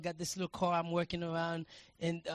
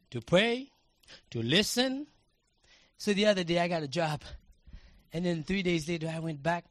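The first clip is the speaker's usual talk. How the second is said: ordinary delivery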